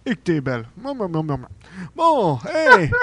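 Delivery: swooping, doting hello to a favorite; delighted Santa Claus. Acted. Santa greets Lynn.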